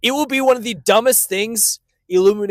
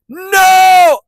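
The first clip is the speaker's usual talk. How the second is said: mock shout; 14 semitones higher, 11 dB louder than usual. Mock outburst at the cohost.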